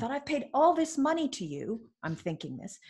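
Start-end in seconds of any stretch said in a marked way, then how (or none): 0.1-1.9 retold high-pitched indignation; angry. Client silently protesting to paid coach, indignant. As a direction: complain in high, aggrieved protest. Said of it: "I got so angry".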